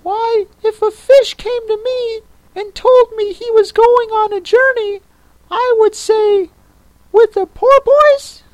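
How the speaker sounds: very high creature voice; Mock Turtle. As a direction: squeak it out in a tiny, very high voice.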